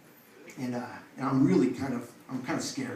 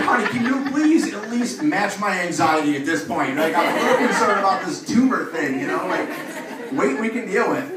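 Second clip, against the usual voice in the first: higher, louder pleading with a wife; exasperated plea; 5 semitones higher, 6 dB louder than usual. Retold or imitated. Husband begging his wife to share his worry, exasperated. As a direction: plead in mock exasperation, voice pitched up.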